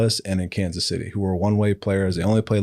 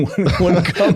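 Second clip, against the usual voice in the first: laughing; 10 semitones higher, 4 dB louder, slower than usual. Real, not acted. Laughing about Josie refusing visits.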